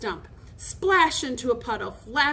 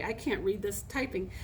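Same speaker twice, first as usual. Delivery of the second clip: ordinary delivery, nothing marked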